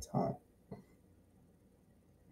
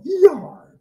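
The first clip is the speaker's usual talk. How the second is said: much higher, loud pirate yell; pirate cry; 5 dB louder than usual. Retold or imitated. Kid showing how to fight a pirate, pirate yell. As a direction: bark it like a cartoon pirate swinging a sword. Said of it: "You're supposed to go".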